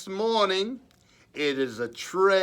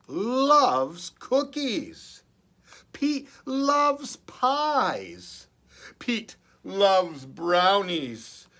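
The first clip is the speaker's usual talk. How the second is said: slow, louder, leaning on loves; savouring list; 4 semitones higher, 4 dB louder, slower than usual. Acted. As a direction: linger over each treat.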